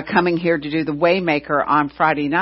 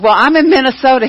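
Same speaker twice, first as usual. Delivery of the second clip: louder, higher exclaiming protest; 5 semitones higher, 7 dB louder, faster than usual. Retold or imitated. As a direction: blurt it out, caught off guard by the early call. Early call while out of town.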